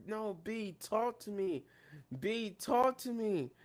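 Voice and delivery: hushed, drawn-out mock pleading; imitated tearful plea. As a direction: mimic someone pleading through tears. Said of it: "And she's crying".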